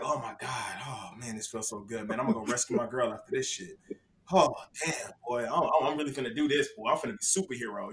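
murmuring happily to himself mid-pee; blissful inner voice. Retold or imitated. Retold blissful inner monologue.

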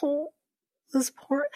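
soft, high-pitched little coo of pity; emotional. Retold or imitated. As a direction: feel for him, small high voice. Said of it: "I got a little emotional".

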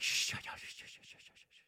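whispered shushing. Retold or imitated. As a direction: hush the table with a whispered shh. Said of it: "being like".

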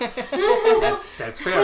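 laughing through the words. Real, not acted. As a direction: squeal it out mid-laugh. Amused reply to spouse joke.

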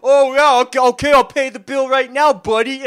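loud, high blustering comeback; hammed-up belligerence; mock cocky retort. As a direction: imitate someone calling a bluff. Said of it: "how he was".

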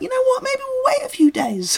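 squeaky silly villain voice; terrorist voice. Retold or imitated. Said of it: "I'd be like".

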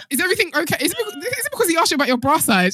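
high, fast, laughing mock concern; laughing mock worry about the bra question; teasing through laughter. Real, not acted. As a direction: tease her while giggling. Teasing friend while laughing.

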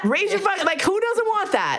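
high-pitched, impassioned challenge; incredulous excitement. Real, not acted. Speaker challenging the audience, high incredulous excitement. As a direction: demand it in giddy disbelief.

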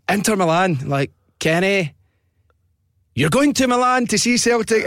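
high-pitched radio winner reveal; excited prize announcement. Retold or imitated. Presenter telling Kenny he's won Milan trip, excited. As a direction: build to a high, triumphant DJ cry.

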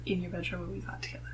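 whispered teasing jab; whispered aside to husband. Real, not acted. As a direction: drop to a whisper to tease him.

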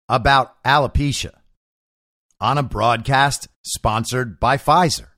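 ordinary talk, nothing marked

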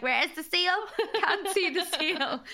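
high, slowing comic whine; mock searching. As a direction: play-act peering about, voice pitched high. Mocking a pretend search.

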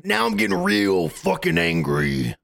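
bad Hulk Hogan impression, slow and showy. Shown as a demonstration. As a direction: do a wrestling-promo villain, slowly. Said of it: "Hulk Hogan".

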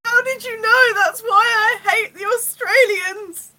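high, loud, slow wail; hammed-up outburst. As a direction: cry it out in mock anguish. Acted outburst as scene ends.

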